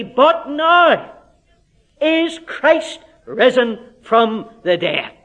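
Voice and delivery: loud rising "But now", then slow, weighty "is Christ risen from the dead"; triumphant emphasis. Shown as a demonstration. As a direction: ring out the turn on "now". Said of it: "he changes his tone completely".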